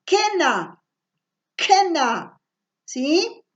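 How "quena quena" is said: "quena" set apart clearly, hard k first; word demonstration. Shown as a demonstration. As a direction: model "quena" with a crisp opening k. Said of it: "la palabra quena".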